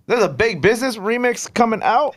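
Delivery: high, loud surprised question. Real, not acted. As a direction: ask it in sudden disbelief. Surprised news of a remix.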